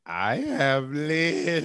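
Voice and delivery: hammed-up life-is-complete sigh; mock blissful fulfilment. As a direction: stretch each word as a grand contented declaration. Listener voices his blissful fulfilment.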